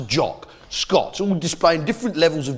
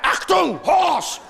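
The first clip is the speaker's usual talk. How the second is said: higher-pitched mock shout of warning; mock alarm; 9 semitones higher, much slower than usual. Enemy being freaked out by horse artillery, mock alarm. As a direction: sound a comic enemy alarm.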